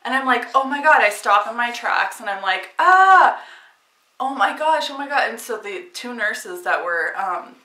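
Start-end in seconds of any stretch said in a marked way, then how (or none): 0.5-1.0 higher and louder, caught off guard; reliving the gush of water, startled and loud; shocked exclamation. Retold or imitated. Water gushes in hospital hallway. 2.8-5.3 high, loud alarmed cry fading down; reliving the shock of her water breaking; panicked exclamation. Retold or imitated. Water gushes in hospital hallway.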